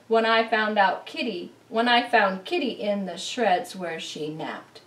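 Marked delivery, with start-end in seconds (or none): none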